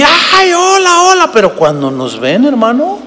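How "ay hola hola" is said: high, slow, squealing mock fan; hammed-up squeal of an excited crowd. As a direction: shriek like a swooning fan. Fans shrieking greetings.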